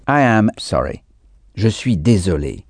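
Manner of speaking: ordinary talk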